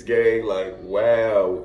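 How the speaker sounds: ordinary talk, nothing marked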